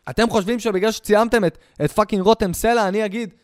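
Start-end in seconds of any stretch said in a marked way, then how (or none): none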